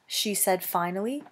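ordinary delivery, nothing marked

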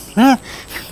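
short high laugh; light real chuckle; amused chuckle. Real, not acted. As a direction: laugh at your own joke. Laughs at own joke.

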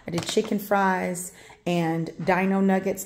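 ordinary talk, nothing marked

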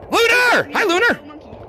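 high, sudden shout of greeting; excited name call. Real, not acted. Streamer hailing a player just spotted, high excited call.

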